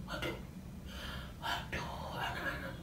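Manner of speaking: put-on soft shocked whisper to the kids; hushed whisper. A narrator's reading. Storyteller whispers to the children about the sin, hushed. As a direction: draw the children in quietly.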